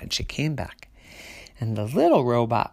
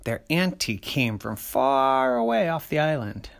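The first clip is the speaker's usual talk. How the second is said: ordinary delivery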